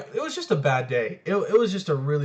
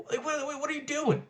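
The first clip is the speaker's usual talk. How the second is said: higher-pitched, disbelieving challenge; mock incredulous scolding; 9 semitones higher, 5 dB quieter than usual. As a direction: scold a quitter in high disbelief. Acted scolding of a quitter.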